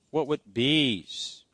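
usual speaking voice

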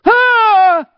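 sudden high, loud scream; piercing acted outburst; imitated scream. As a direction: yell out as the panicking passenger. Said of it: "Just like that".

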